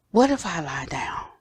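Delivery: soft unvoiced musing; whispered thought. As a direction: breathe the question like drifting off. Man's inner question, lying down.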